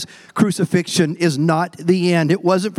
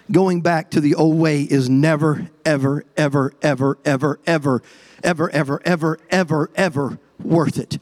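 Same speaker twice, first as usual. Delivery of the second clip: a long chain of EVER, ever, ever, each one hit and dropping; relentless repeated ever; stacked evers; 7 semitones lower than usual. Real, not acted. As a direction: pile up the evers to hammer the point home. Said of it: "Did I use enough evers".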